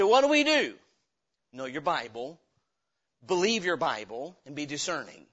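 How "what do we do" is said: higher, louder mock question from the listener; imitating the congregation. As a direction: voice the crowd's cry. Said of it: "You say".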